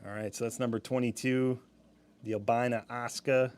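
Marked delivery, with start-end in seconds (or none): 2.2-3.5 hammed-up, badly faked New York accent; terrible New York accent. Shown as a demonstration. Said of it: "That's my terrible New York accent".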